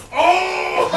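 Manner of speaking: sudden delighted shout of oh; excited high oh. Retold or imitated. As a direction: blurt it out, thrilled and loud. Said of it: "the guy was just like".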